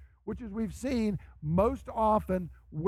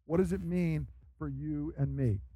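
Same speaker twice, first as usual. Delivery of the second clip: usual speaking voice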